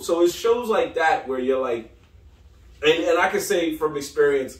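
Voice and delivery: ordinary talk, nothing marked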